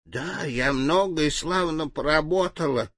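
put-on toothless old mouse mumbling; sweet old lady. A narrator's reading. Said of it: "прошамкала старушка беззубым ртом".

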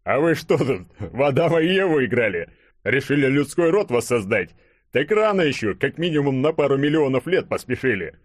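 high, loud, hilarious ribbing; guffawing tease. A narrator's reading. Hero mocking his naked friends as Adam and Eve, loud amused teasing. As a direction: tease them as if cracking up. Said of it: "разразился хохотом".

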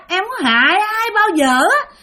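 vengeful ghost Bình greeting the grandfather, shrill and eerie. Acted. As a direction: play the dead woman, high and unsettling. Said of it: "giọng bà thấy ghê lắm, the thé".

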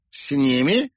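high, puzzled echo question; blankly puzzled. A narrator's reading. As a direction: repeat it without understanding. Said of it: "повторила женщина, тупо глядя".

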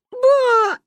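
high, loud whiny wail; a little girl whines at grandma; whining child. Acted. Girl whines loudly to grandma about the X-rays. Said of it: "ныла Манюня".

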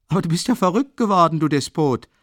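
furious fast roar; thundering. Acted. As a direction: thunder at the stubborn friend. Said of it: "donnerte ihn Rasumichin an".

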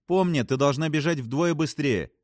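high, urgent warning. A narrator's reading. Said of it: "предупредила Фрэнсис". (предостерегая, повышенным голосом)

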